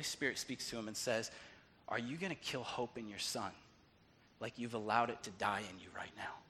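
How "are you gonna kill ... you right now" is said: low, very soft voice sinking to a whisper; low and searching, nearly whispered; hushed divine prompting. Retold or imitated. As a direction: speak as a quiet inner voice. Holy Spirit's voice in story.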